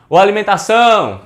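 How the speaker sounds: loud, high holler of "alimentação", ÇÃO held long; calling at the door. Shown as a demonstration. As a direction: call out "alimentação" to someone behind a door. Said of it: "bate aí".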